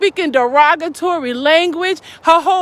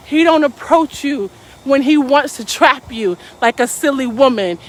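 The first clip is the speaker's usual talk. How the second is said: usual speaking voice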